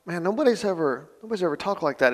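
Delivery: a lightly voiced, stunned young rabbi; sub-rabbis telling their bosses, lightly awed; awed trainee.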